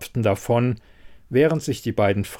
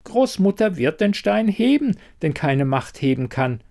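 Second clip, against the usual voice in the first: high-pitched, confident child's declaration, lightly voiced; convinced; 8 semitones higher than usual. A narrator's reading. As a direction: declare it with full faith, pitched up. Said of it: "sagte Lornu überzeugt".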